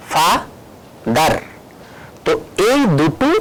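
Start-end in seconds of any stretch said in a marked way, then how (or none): 0.1-1.3 father said very slow as ফা then দার; syllable-split demo. Shown as a demonstration. Teacher sounding out the word for the class, very slowly. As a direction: break father into two slow beats. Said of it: "সেটাকে আরেকটা syllable বলা হবে".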